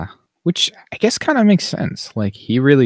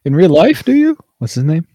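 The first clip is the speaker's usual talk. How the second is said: much higher, louder mock disbelief; mock shock; 9 semitones higher, 4 dB louder than usual. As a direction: play the gasping parents, overdone. Mocking the parents' shocked reaction.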